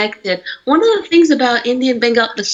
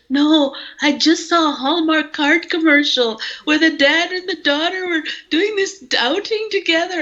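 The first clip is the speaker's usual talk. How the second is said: dad crying; 7 semitones higher than usual. Retold or imitated. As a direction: imitate her father choking up over an ad. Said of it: "he was crying".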